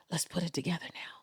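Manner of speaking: hushed, breathless cue; whisper. Retold or imitated. Coach whispering to the audience to start. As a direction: lean in and whisper it to the room.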